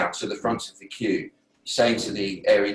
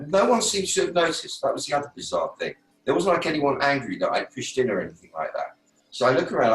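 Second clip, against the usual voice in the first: ordinary talk, nothing marked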